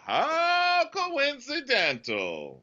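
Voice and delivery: drawn-out, high-swooping incredulity; mock sarcasm. Khaleesi doubting Jon's story, exaggeratedly sarcastic.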